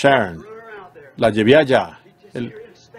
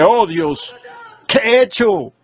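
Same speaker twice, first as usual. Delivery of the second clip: anguished prayer; 6 semitones higher than usual. Retold or imitated. Grieving father pleading with God in prayer, anguished and high.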